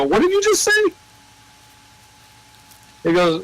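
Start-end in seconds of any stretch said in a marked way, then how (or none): 0.0-0.8 sharply higher incredulous question; high squeak of disbelief; shocked disbelief. Retold or imitated. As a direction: act floored by what you just heard. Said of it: "And I go".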